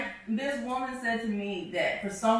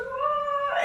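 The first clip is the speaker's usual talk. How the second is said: squeal. Retold or imitated. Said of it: "she's like".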